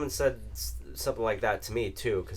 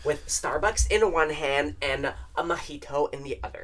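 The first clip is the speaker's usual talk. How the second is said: put-on bright snooty preppy girl; 8 semitones higher, 13 dB louder than usual. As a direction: ham up a spoiled rich girl. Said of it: "That's the only girl's thing".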